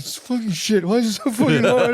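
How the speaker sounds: high, hammed-up despair; comic meltdown, pitch shooting up; exasperated outburst. Acted escalation to exasperated outburst.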